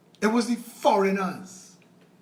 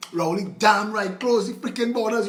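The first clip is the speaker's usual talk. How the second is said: normal talking voice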